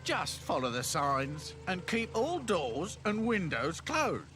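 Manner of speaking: high, slow drawn-out instruction; gate man. Acted. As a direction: spell out the rules slowly, pitched high.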